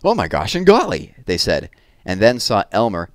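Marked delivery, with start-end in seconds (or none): 0.0-1.1 high, loud startled exclamation; startled cry. Acted. Startled elephants crying out after Elmer's boo. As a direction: yelp it out in shock. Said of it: "fell all ways in surprise".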